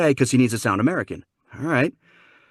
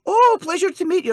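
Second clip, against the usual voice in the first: high, loud, delighted Scottish greeting; Scottish mom; 5 dB louder than usual. Retold or imitated. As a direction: greet like a cute little Scottish lady. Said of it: "cute little Scottish lady".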